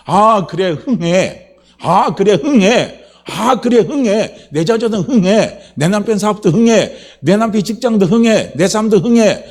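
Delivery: high, loud rallying cry; loud repeated proclamation, pitched high; rousing declaration. Acted. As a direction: declare it boldly, hitting every 흥해. Acts out confident rallying declarations.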